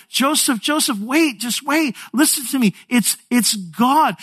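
high-pitched acted plea; urgent pleading. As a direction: imitate a wife begging to be heard. Acted Mary begs Joseph to listen.

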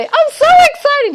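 hammed-up thrilled wife; gushing excitement. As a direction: squeal it like big news bursting out. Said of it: "like I'd say".

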